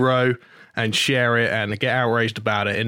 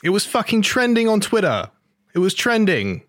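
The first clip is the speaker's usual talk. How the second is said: incredulous outburst; 8 semitones higher than usual. Real, not acted. Outraged emphasis.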